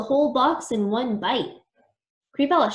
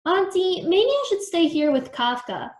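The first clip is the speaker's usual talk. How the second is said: put-on high-pitched child-like appeal; high, tentative suggestion; piping up; 7 semitones higher than usual. A narrator's reading. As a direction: pipe up with a lifted voice. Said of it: "Shivereen piped up".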